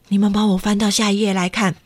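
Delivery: ordinary delivery, nothing marked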